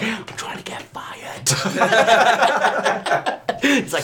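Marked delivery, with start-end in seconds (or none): 0.0-1.5 a soft unvoiced mock whisper, higher. Imitating the EasyJet guy in a sly whisper. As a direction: breathe it like a conspiratorial aside. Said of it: "He's like".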